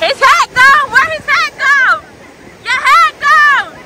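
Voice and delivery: high, slow, drawn-out teasing about the hat; amused high exclaiming. Real, not acted. Child's hat lost to wave.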